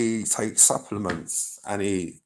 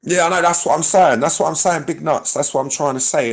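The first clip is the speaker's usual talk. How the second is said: louder and higher, pressing the point; emphatic insisting; 8 semitones higher, 8 dB louder than usual. Real, not acted. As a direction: hammer it home to the chat. Insisting to chat, raised voice.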